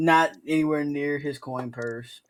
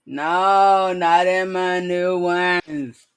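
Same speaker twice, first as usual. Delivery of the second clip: loud, drawn-out no, then very slow; slow drunk objection; drunken refusal; 4 dB louder, much slower than usual. Acted. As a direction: whine it like a drunk who won't move. Drunk protests being dragged out.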